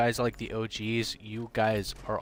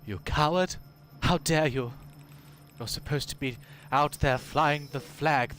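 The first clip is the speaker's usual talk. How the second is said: higher, hammed-up patriotic scolding; British side scolding; 4 semitones higher than usual. His British side scolds him in the mirror, mock outrage. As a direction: berate yourself like a mirror villain. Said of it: "see if my face just start going".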